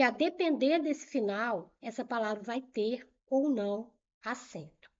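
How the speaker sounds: normal talking voice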